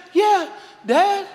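put-on five-year-old answering her teasing dad; small daughter. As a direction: play a happy little girl. Daughter voiced.

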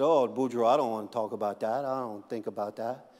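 low, soft, lightly voiced wife brushing it off; a hint of the coy wife dodging the question; wife avoiding the question, low and soft; wife's reluctant reply. Wife answers husband in joke.

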